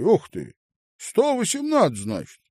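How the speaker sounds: loud, high-pitched amazement; surprised exclamation. A narrator's reading. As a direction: marvel loudly at the total. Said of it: "он удивлённо качнул головой". (удивлённо, высоко и громко)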